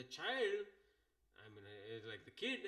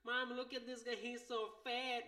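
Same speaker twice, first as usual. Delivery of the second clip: put-on bratty kid voice; 10 semitones higher than usual. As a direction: voice the rude kid jeering to his mom. Kid mocking a passenger to mom.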